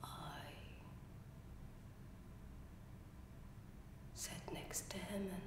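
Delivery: low, very soft near-whisper with long pauses; quiet, halting and secretive; hushed confession. Acted. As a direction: confess a killing under your breath. Acted confession of killing stepfather.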